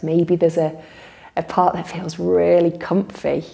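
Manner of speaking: calm guided relaxation with long pauses; guided meditation. Real, not acted. Teacher leading listeners in a body-awareness relaxation, unhurried meditation voice. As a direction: guide them gently through noticing breath and body.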